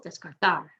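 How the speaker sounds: "descartar" stressed des-car-TAR; last-syllable stress. Shown as a demonstration. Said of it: "emphasize the last syllable".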